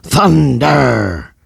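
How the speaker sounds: high, loud metal yell; rock scream. A narrator's reading. Rod opening the heavy metal jam, loud high yell. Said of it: "Rod screamed".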